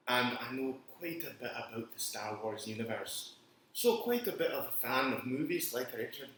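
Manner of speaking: put-on Scottish accent. Co-host introducing himself on the show, put-on Scottish accent. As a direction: introduce yourself in a playful Scottish brogue. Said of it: "It sounds like you're from Scotland".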